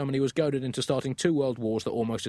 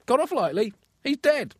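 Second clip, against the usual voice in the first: much higher, mock outraged squeal; outraged disbelief; 12 semitones higher than usual. Pig owner aghast at farmer's excuse, high indignant. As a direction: play the pig owner's shock, hammed up.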